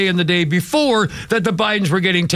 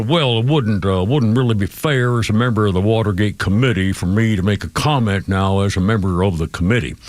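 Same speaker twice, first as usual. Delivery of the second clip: lightly imitating a Georgia politician's accent; a hint of an old Georgia senator's accent; 8 semitones lower than usual. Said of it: "I can't do his, uh, his, uh, uh, accent justice".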